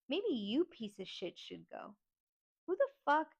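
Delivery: angry retort building to a high, loud burst; indignant anger. Retold or imitated. Niece turning on relatives who told her to leave, outraged. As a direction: fire it back, exploding on the last words.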